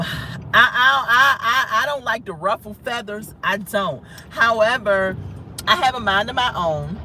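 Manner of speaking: ordinary talk, nothing marked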